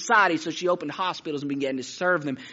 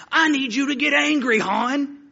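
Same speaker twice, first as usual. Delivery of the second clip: high, insistent mock coach yell; frustrated coach; 7 semitones higher, 4 dB louder than usual. Frustrated coach demanding fire from a young player, worked up. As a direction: play a worked-up football coach riling a kid. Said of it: "he was really frustrated".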